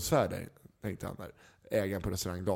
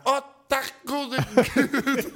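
high, slow drawn-out relief; relieved gasp; 14 semitones higher, 4 dB louder, much slower than usual. Retold or imitated. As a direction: sigh out thank God as if rescued. Owner relieved they only drink.

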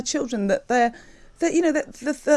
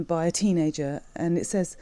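ordinary delivery

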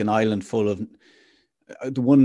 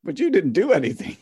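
put-on higher-pitched child's objection; mock kid voice, pitched up; protesting kid; 7 semitones higher than usual. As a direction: pipe up like an indignant kid. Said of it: "one of the kids goes".

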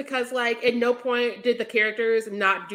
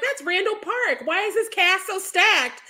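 excited disbelief; 7 semitones higher than usual. Retold or imitated. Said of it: "and I'm like".

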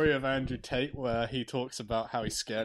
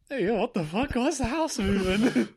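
mock alarm; 8 semitones higher, 3 dB louder than usual. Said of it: "it's just like".

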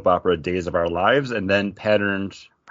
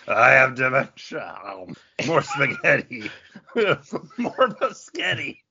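put-on higher, slow, laughing old-man voice; mock confused old dad; 6 semitones higher than usual. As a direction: play the dazed dad asking for more spaghetti. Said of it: "The dad just kept eating 'em".

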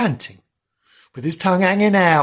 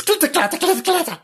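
high clattering chant; much faster than usual. A narrator's reading. Skittles falling sound words.

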